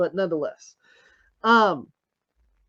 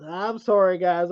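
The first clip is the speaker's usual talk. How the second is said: ordinary delivery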